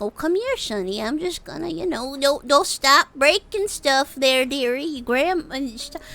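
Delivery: high sweet granny, slow in places; grandma voice. Pretend. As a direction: coo like a doting old grandma. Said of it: "be like a little old grandma".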